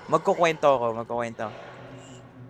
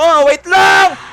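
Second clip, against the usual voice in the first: high, very loud yell; shouting; 10 semitones higher, 14 dB louder, much slower than usual. Real, not acted. Streamer telling someone rushing the story to wait, shouting. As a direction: yell to hold on. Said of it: "Ninigaw ka eh!".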